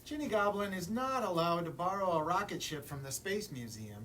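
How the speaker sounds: plain reading voice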